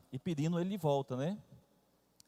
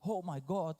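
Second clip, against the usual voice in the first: higher mock English accent of a little girl; imitating an English accent; 5 semitones higher, 3 dB louder, slower than usual. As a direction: repeat it a bit higher, still in a child's English accent. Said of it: "falando assim com um sotaque já".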